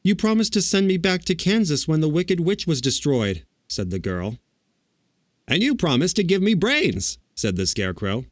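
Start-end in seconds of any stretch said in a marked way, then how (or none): 0.0-3.4 put-on young girl voice. A narrator's reading. Dorothy's voice. 5.5-7.2 high, bright straw man; Scarecrow voice. A narrator's reading. Scarecrow speaks.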